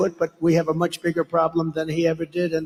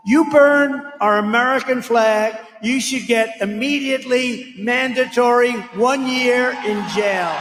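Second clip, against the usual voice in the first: rally shout; 5 semitones higher, 4 dB louder than usual. Real, not acted. Leader shouting a jail demand to the rally crowd. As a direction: hammer each word at the crowd.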